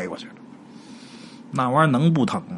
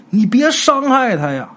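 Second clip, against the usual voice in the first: pleading; 9 semitones higher, 3 dB louder, slower than usual. Acted. Chen Si pleads with ghost.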